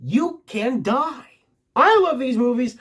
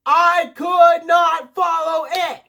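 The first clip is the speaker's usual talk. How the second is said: exasperated shout; 9 semitones higher, 5 dB louder, much slower than usual. Acted. Exasperated outburst about princess movies.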